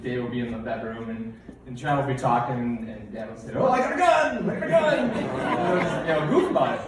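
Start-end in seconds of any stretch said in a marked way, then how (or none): 3.5-5.1 high, louder playful yelling; mock joke shout. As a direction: yell it as a running gag. Dad's joke shout repeated.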